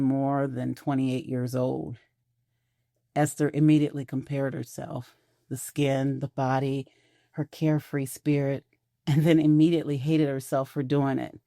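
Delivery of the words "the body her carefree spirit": usual speaking voice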